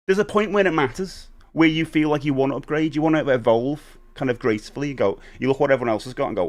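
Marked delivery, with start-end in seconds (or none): none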